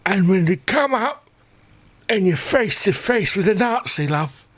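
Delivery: put-on high, frail old war veteran reminiscing; old soldier voice. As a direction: play a doddering veteran boasting to love.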